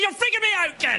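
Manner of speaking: very high mock-panicked yell; exasperated father. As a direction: blow up at your kid for laughs. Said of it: "We had our first argument".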